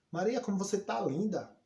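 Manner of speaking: high, loud, overdone praise; sarcastic compliment. Shown as a demonstration. Teacher's irony example, praising Maria while trashing her, sarcastic. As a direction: gush with fake admiration to mock her. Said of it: "você tá detonando ela".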